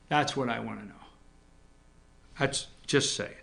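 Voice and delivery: hushed "That's just saying" under the breath; whispered aside. Real, not acted. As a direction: let it slip as a secret.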